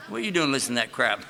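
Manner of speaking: ordinary talk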